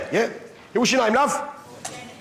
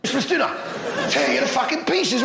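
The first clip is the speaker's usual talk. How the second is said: usual speaking voice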